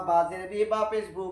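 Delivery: ordinary delivery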